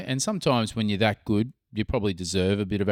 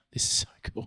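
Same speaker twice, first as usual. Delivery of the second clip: awed whisper; 6 dB quieter than usual. Retold or imitated. Delighted when beers arrive.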